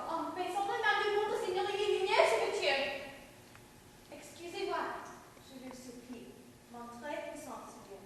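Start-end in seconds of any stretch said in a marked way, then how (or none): 4.1-8.0 much softer, bowing low; humble quiet plea. Acted. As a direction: murmur the apology with deference. Princess humbly apologises to king.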